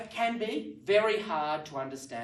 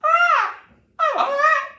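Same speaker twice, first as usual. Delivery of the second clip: put-on very high, loud baby babble; mimicking a babbling baby copying its parents; 17 dB louder, much slower than usual. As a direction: squeal like a toddler copying tunes. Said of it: "you laugh at children because they go".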